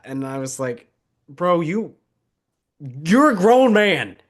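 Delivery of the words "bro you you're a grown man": rising to a loud, exasperated scold; exasperated retort. Retold or imitated. Friend scolding a picky eater, rising exasperated scold. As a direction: tell a grown man to get over it.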